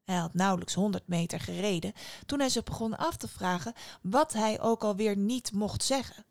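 ordinary delivery, nothing marked

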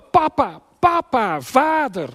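high, loud shout for father; crying out. Retold or imitated. Child in mortal need calling his father, imitated cry out. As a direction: shout each word out, pitched far above speech. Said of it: "En hij roept".

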